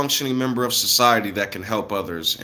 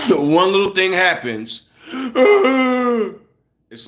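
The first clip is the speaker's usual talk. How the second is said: laughing gasp; 7 semitones higher, much slower than usual. Real, not acted. Laughing at Karens cracking.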